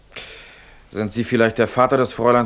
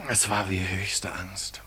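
slow, soft whisper; slow awed whisper; 7 dB quieter, slower than usual. Acted. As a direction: breathe the fear out slowly. Student recalls the dream.

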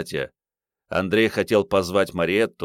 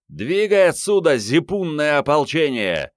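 yelling; 9 semitones higher, 4 dB louder than usual. A narrator's reading. Genka chases off the peasant militia, loud yell. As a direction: holler it to scatter a crowd. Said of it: "Генка завопил".

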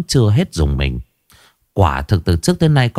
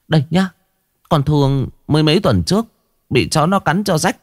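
higher, anxious and insistent; worried wife; 6 semitones higher than usual. Acted. Wife at the table urges her husband that the house is cursed, higher and anxious. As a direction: press your husband, voice lifted by worry.

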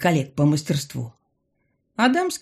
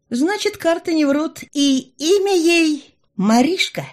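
high, slow mystic pronouncement; drawn-out fortune teller reveal; 11 semitones higher, 5 dB louder than usual. A narrator's reading.

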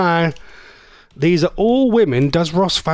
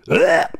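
short disgusted retching noise; mock retch. Host reacting to a suggested celebrity, mock retch. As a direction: fake a quick gag of disgust. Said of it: "makes me wanna be sick".

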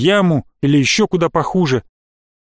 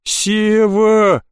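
high, drawn-out plaintive call; plaintive whimper; 5 semitones higher than usual. A narrator's reading. Trapped boy calling his friend, whimpering. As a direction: whine the name for help. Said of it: "послышалось жалобное хныканье".

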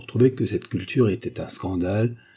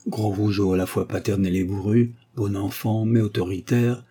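usual speaking voice

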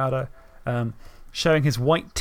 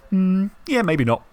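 callous; 6 semitones higher, 4 dB louder, slower than usual. Retold or imitated. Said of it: "him just callously going".

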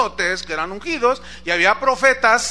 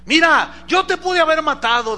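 raised, high pleading call; insistent calling; 7 semitones higher, 5 dB louder than usual. Acted. David shows Saúl he spared him, loud insistent voice. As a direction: press the point loudly across a distance.

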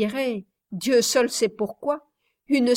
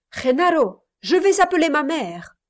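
loud, higher furious cry; 5 semitones higher, 4 dB louder than usual. A narrator's reading. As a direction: shout it out in fury. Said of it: "exclama Reine furieuse".

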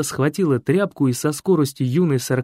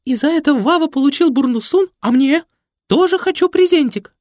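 high, loud, pouting demand; wheedling diva; 6 dB louder than usual. A narrator's reading. Mother whining to Fred for a present. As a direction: whine for a present.

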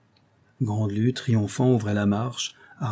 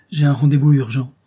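louder, raised protest; resisting; 4 semitones higher, 9 dB louder than usual. A narrator's reading. André resists Rose's dinner invitation, protesting. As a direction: plead an urgent excuse. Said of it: "André résistait encore".

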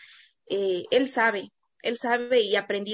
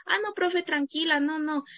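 put-on kids' voice; 6 semitones higher than usual. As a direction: comfort the teacher like a pupil. Kids reassuring teacher.

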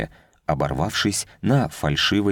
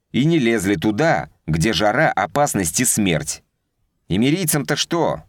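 raised, heated soldier's complaint; indignant rant; 5 semitones higher than usual. Acted. Scout commander ranting about the desert.

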